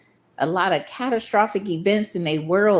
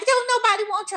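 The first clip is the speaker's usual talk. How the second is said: "Don't nobody want you" squeaked much higher, sing-song taunt; mocking high sister voice; faster than usual. Mocking the little sister's taunt about the ride, high squeak. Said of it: "She like".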